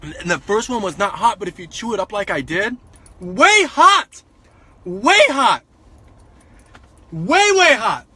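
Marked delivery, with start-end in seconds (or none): 3.2-4.2 high excited cry. Real, not acted. Repeats way hot. 4.8-8.1 excited. Real, not acted. Repeats way hot.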